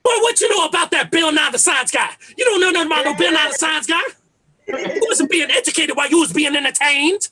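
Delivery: high, loud, badgering challenge; obnoxious old head. Comedian as future old head defending his cartoons, loudly obnoxious. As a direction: mimic your dad's old-head hollering. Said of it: "I'm gonna be an old head too".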